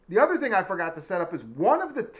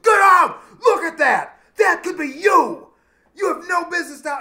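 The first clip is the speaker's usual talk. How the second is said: much higher and louder shouting; screaming cop; 12 semitones higher, 11 dB louder than usual. Retold or imitated. Cop pulling teens out at murder scene, screaming. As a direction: scream them out of the car. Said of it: "just screaming at us".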